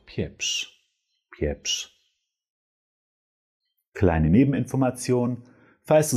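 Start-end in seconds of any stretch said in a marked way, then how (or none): 0.1-1.9 "Pieprz" nearly whispered, quieter, ending in sh; soft whispered ending. Shown as a demonstration. Teacher softly repeating the gentle ending. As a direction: breathe "Pieprz" out softly, sh at the end. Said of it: "Mit so einem S C H Laut".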